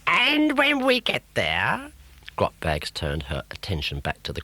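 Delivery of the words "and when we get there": high, slow gloating witch. Acted. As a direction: savour the threat.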